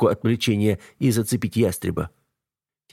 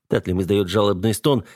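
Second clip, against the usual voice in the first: ordinary delivery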